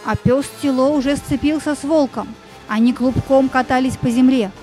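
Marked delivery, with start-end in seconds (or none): none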